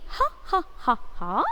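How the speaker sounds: deliberate mock "ha ha" laugh; demonstrated laughter. Shown as a demonstration. Teacher shows students the action for laughing, mock laugh. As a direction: act out laughing, beat by beat.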